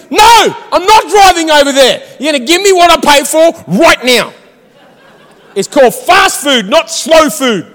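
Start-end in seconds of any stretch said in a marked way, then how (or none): 0.1-4.3 indignant, raised-voice refusal; frustrated. Retold or imitated. Customer refusing to park at drive-through, indignant. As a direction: refuse hotly, hammed-up outrage. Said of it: "I get frustrated".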